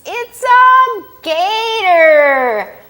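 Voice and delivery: slow, high mock scream; frog screams. Frog screaming at the gator, hammed-up shriek. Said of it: "and the frog screams".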